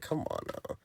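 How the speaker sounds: breathy whispered come on now; exasperated whisper. Retold or imitated. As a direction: whisper it at the screen in disbelief. Reacting to the woman in danger.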